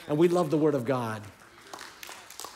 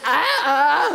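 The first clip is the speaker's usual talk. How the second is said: put-on possessed child wail; 8 semitones higher, 8 dB louder than usual. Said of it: "another child looked possessed".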